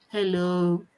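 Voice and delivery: low, subdued little hello; reluctant greeting. Retold or imitated. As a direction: greet as if made to, pitched low. Acts daughter's reluctant greeting.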